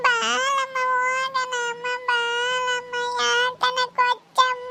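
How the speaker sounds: put-on high, slow tearful child's whine; crying whine. A crying child begs her mother, whining. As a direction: sob it out like a child begging.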